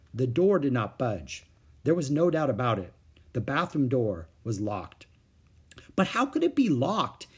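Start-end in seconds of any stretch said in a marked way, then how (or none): 6.0-7.3 put-on puzzled boy. A narrator's reading. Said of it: "Henry asked".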